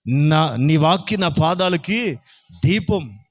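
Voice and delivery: ordinary talk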